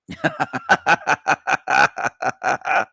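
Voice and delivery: long staccato laugh; mocking laugh. Real, not acted. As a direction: burst into a run of derisive chuckles. Laughs at own taunt.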